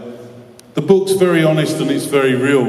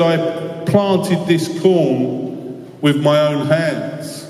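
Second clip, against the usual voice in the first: slow proud boast; 2 semitones higher, much slower than usual. Acted. Farmer boasting to priests.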